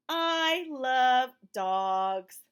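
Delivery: very slow and loud, each word spaced; slow read-aloud. Shown as a demonstration. A mother reading aloud, slow and loud. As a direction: read it out slowly for a toddler. Reads the book's refrain slowly.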